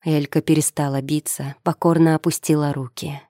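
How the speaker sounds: usual speaking voice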